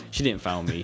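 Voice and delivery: ordinary delivery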